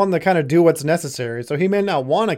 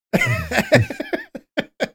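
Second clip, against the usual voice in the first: high burst of laughter at own joke. Real, not acted. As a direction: cackle at the joke.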